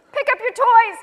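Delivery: loud high angry yell. Acted. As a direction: yell it at the kids. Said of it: "she yelled".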